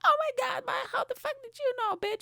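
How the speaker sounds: put-on squealing, very high girly falsetto; hammed-up excited girl voice; imitated girl listeners. Girls amazed he knows about sales, high mock squeal. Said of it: "if there's any girls listening, they'll be like".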